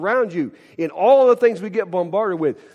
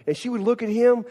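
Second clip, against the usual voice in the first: ordinary talk, nothing marked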